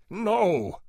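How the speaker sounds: high, loud outburst of refusal; trumpeting shout. A narrator's reading. Janitor leaping up at his tormentor, trumpeting shout. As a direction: cry out like a trumpet blast. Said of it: "the voice echoed like a trumpet".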